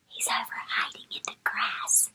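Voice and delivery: hushed secret whisper; hushed voice pointing out a hiding bug. Real, not acted. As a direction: whisper so the bug won't hear.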